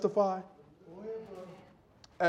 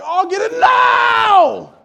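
much higher, very loud, slow stretched shout; girls' excited shout; 13 dB louder, much slower than usual. Retold or imitated. Daughters thrilled the doorbell rang, stretched excited shout. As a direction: scream it out like giddy girls at the door. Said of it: "the girls are like".

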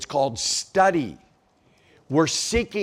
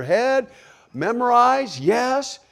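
emphatic preaching; 11 semitones higher, 6 dB louder, slower than usual. Real, not acted. Preacher urging the congregation.

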